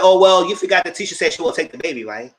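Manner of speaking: loud, fast mocking reminder; loud teasing call-out; teasing jab. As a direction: needle him loudly about what Tisha said. Teasing friend about Tisha.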